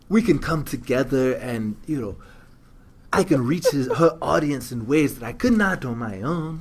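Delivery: light Trevor Noah impression; lightly voiced South African accent. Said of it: "That's your South African voice?".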